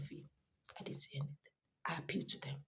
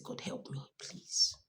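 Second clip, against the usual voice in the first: soft pleading whisper to God; hushed desperate prayer; 8 dB quieter than usual. Retold or imitated. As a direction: beg quietly, almost breathless. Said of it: "I said".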